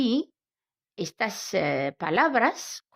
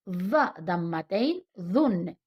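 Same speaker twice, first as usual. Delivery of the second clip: normal talking voice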